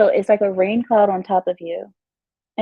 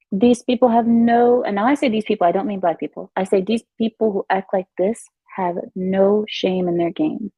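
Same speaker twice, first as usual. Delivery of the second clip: usual speaking voice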